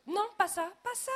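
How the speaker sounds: high, loud frantic protest; panicked. A narrator's reading. Director begging his wife to stop, panicked. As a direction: stop her in alarm.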